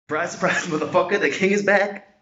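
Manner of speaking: high, loud Irish fighter boast; poor Conor McGregor impression. Lifter boasting like a cocky Irish fighter after his record. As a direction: strut it like a cocky Irish champion. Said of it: "really poor impression of U F C star Conor McGregor".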